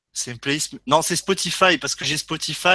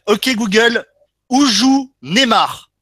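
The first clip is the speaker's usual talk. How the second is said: slow clear command; 5 semitones higher, 7 dB louder, slower than usual. Real, not acted. Speaking a command to the phone.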